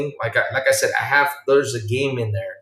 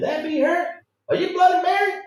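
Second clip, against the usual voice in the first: excited squeal. Real, not acted. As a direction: squeal with delight at the discovery. Recognizing Bloody Mary.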